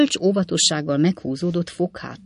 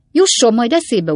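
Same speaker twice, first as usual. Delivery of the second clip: loud, raised warning; narrated angry interjection; threatening retort; 7 semitones higher, 8 dB louder than usual. A narrator's reading. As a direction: threaten over the other's insults. Fogg threatens over Pickwick's shouting.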